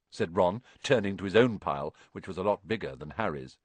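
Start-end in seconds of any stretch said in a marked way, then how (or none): none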